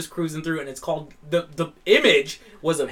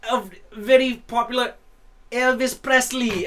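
European fob voice; 5 semitones higher than usual. Retold or imitated. Foreign Man announcing his [name] impression, mild accent. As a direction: lightly imitate the foreign man. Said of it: "this European fob voice".

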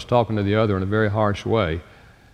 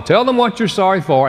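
ordinary talk

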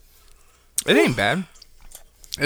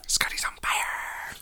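whispering; 9 dB quieter, slower than usual. Pretend. Whispered joke echo.

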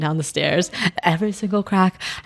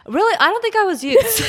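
surprised; 13 semitones higher than usual. Real, not acted. Surprised denial.

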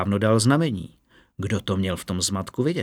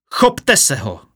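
louder barked order; captain's roar; 3 semitones higher, 5 dB louder, slower than usual. A narrator's reading. Captain orders the guards to seize the prisoner, louder shout. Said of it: "zařval kapitán".

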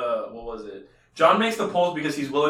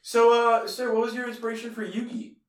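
a touch of a formal interviewer, pitched up; mock interviewer; 8 semitones higher than usual. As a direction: ask the creator politely, a bit higher. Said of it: "the interview was like".

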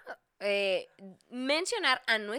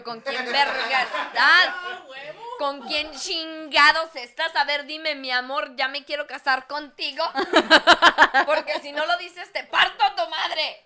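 slow, louder, laughing mock threat; mock jealous threat; 3 semitones higher, 6 dB louder than usual. Retold threat to her boyfriend, laughing mock fury. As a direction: fake fury while cracking up.

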